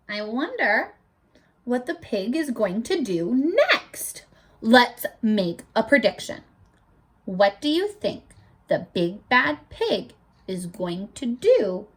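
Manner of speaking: usual speaking voice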